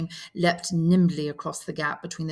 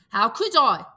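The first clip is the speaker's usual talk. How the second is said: higher, louder indignant cry; 6 semitones higher, 9 dB louder than usual. Acted. Piggy protesting to Ralph, indignant. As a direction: protest the unfairness. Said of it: "said Piggy indignantly".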